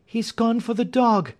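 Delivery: high-pitched frightened warning; high, anxious exclamation; alarmed cry. A narrator's reading. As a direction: cry out in alarm without much volume. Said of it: "cried Miss Hunter".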